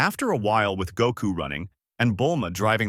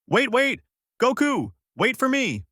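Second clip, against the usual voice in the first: shouting after him; 14 semitones higher than usual. A narrator's reading. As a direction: yell to catch up before he leaves. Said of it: "shouting out".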